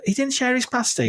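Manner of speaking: high-pitched, playful indignation; mock outrage. Host reacts to the miner not sharing his pasty, mock outrage. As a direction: act lightly scandalised.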